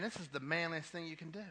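usual speaking voice

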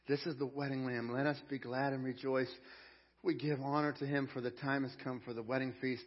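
ordinary talk

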